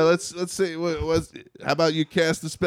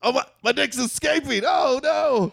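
mock panic; 13 semitones higher than usual. Panic as dick escapes.

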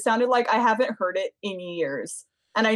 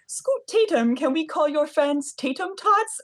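interviewer voice; 7 semitones higher than usual. Retold or imitated. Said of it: "Jervis Cottonbelly was like".

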